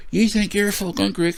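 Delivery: high, slow mock voice of a drunk heckler; sneering impression of a bar heckler; mocking the aggressive guy. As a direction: put on a silly high voice for the troublemaker. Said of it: "this is the way, this is the way he talk".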